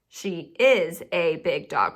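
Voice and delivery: slow, each word separate in "She is a big dog"; word-by-word teacher reading of "She is a big dog"; spaced-out words. Shown as a demonstration. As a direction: leave space between "is" and "a", stressing "is". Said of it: "We definitely gotta put a space in between those words".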